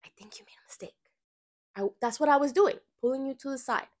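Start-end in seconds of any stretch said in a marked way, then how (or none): 0.1-0.9 voiceless, secretive whisper; whispered aside. Retold or imitated. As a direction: whisper it like a private sidebar. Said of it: "a sidebar".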